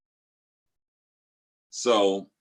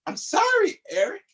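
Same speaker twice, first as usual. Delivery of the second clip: much higher, sugary mock sorry; mother's fake apology. Mother apologising to the scalded father, mock sweet. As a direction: play the innocent wife, sweetly insincere. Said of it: "my mother's like".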